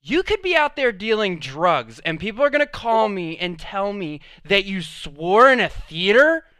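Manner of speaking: can't believe the fuss, let it rise; exasperated mom. Retold or imitated. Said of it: "And she was like".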